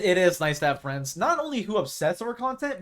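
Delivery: normal talking voice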